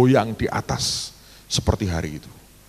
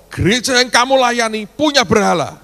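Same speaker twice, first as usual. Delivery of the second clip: higher and much louder, a pressing challenge; booming question; 12 semitones higher, 9 dB louder than usual. Retold or imitated. God pressing the preacher about his church's idol, booming. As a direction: let it ring out like a voice from above. God's final pointed question.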